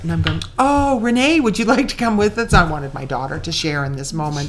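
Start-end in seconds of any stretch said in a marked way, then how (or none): 0.6-2.5 high, bright-voiced mock invitation; eager invitation. Mother invites her little daughter along, retold eager invitation. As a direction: retell a mother's keen invite, pitched up.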